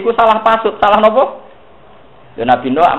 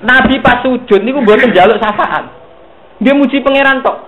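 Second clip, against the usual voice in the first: forceful preaching; 10 semitones higher, 5 dB louder than usual. Real, not acted.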